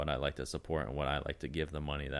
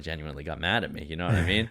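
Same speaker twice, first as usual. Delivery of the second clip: ordinary talk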